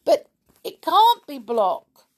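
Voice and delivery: high, insistent objection; protesting. A narrator's reading. Boy arguing with his stepfather about the captive, protesting. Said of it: "protested Sooty".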